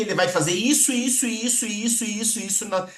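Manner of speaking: piling on benefits. Shown as a demonstration. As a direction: rattle off a chanting run of "isso". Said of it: "vai encher de benefício".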